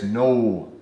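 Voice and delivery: slow, drawn-out North Dakota "no"; North Dakota accent. Shown as a demonstration. Coach showing his funny word, North Dakota accent. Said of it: "I fully lean into who I am".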